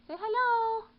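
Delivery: very high, loud cooing to the cat; sing-song pet voice, urging the kitten. Real, not acted. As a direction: coax the kitten in a squeaky pet voice. Talking to kitten, baby voice.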